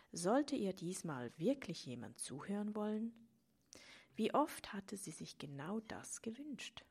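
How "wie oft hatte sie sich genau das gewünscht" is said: plain reading voice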